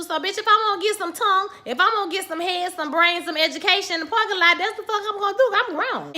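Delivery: sassy defiance. Retold or imitated. Defiant mom quoted.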